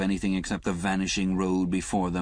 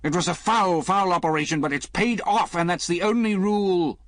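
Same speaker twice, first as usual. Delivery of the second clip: louder and higher, climbing toward a shout; rising to near shout; 12 semitones higher, 6 dB louder than usual. A narrator's reading. Lemus defending the operation to Liz, rising to a near shout. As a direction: build from firm to nearly shouting. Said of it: "his voice rose until finally he was nearly shouting".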